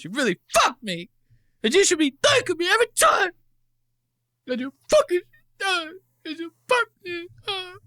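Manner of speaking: very high, fast, squeaky mock yell that turns to a fake cry; mocking Kirk's yell. Streamer mocks Kirk yelling at his co-host, squeaky whiny yell. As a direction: parody his yell, high-pitched and near tears. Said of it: "I love the way Kirk yells".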